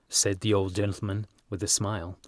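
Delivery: ordinary delivery, nothing marked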